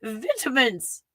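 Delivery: Vitamins with a British short i, VIT-a-mins, high; lightly put-on British Vitamins; British accent. Shown as a demonstration. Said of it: "I switched to British".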